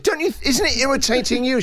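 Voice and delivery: exasperated high plea. Retold or imitated. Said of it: "I said".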